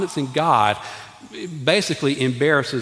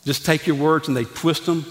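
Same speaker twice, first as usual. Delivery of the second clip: ordinary delivery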